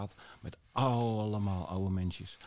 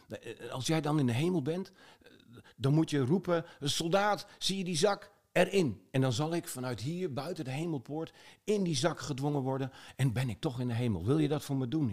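higher pleading request; eager soldier; 6 semitones higher than usual. Acted. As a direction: ask him earnestly for help. Soldier begs man at gate.

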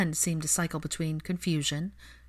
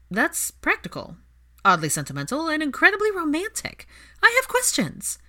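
climbing higher with delight; thrilled squeal building; gleeful rising excitement; 11 semitones higher, 4 dB louder than usual. Acted. Ginny delighted by romantic gesture.

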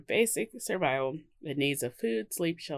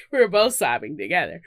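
laughing; 9 semitones higher, 19 dB louder than usual. Real, not acted. Laughing while telling crying story.

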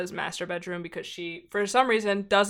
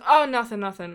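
retold brush-off; 3 semitones higher, 6 dB louder than usual. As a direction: imitate someone hastily dismissing it. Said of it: "He goes".

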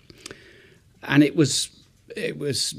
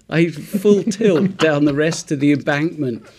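laughing; 3 semitones higher, 5 dB louder than usual. Real, not acted. Laughing while retelling the escape.

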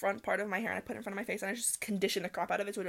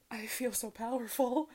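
mock grand wizard; 4 semitones higher, slower than usual. Harry Potter binge fantasy.

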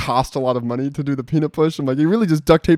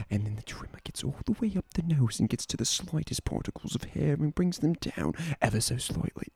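hushed ad narrator; 13 dB quieter than usual. Retold or imitated. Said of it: "and it's like".

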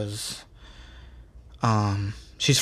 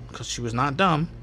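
normal talking voice

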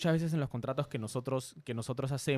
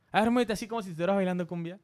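fast, higher mock order to dance; imitating the director friend; 7 semitones higher, faster than usual. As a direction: rattle off the cheeky order quickly and pitched up. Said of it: "me dice". (imitando al amigo, rápido y agudo)

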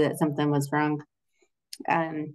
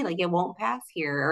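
usual speaking voice

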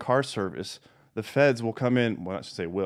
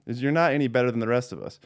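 ordinary talk

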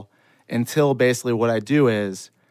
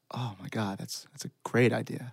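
understated dawning realisation; hushed realisation; 10 dB quieter than usual. Retold or imitated. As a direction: say it quietly to yourself. His quiet realisation in the story.

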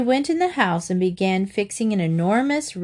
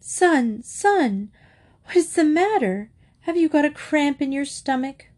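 fretting mother; 7 semitones higher than usual. A narrator's reading. Fretting mother grabs son.

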